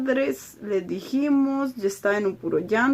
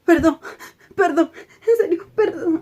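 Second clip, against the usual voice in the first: retold tearful pleading; 7 semitones higher than usual. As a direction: beg forgiveness through tears. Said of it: "en puro llanto". (súplica llorosa, aguda y más fuerte)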